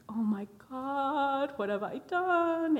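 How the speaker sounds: high, drawn-out cry of dismay; mock panicked wail. Young evangelist reacting as he cries, mock panicked wail. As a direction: stretch the words out in retold horror.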